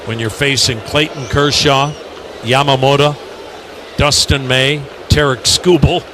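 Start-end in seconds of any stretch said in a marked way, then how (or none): none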